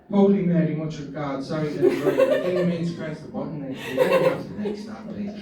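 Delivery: hammed-up Irish accent, laughing through it; a put-on Irish brogue, giggling as you go. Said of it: "It's a fucking amazing Irish accent".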